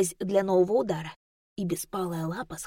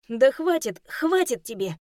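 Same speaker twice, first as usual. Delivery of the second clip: high, loud flustered brush-off; embarrassed protest; 5 semitones higher, 5 dB louder than usual. Acted. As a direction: shake off the hug, embarrassed. Ru squirms in hug.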